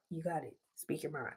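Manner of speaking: barely voiced, almost silent; father silently encouraging his daughter; mouthed. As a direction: mouth it across the room. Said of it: "he mouthed that to me".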